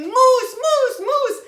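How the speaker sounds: very high, very loud retold scream. Woman alerting her family to a moose, screaming. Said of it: "started screaming".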